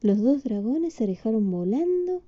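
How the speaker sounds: usual speaking voice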